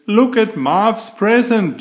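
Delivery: high, bright little-sister voice; Lola's voice. Acted. Little sister showing off a present, high and keen.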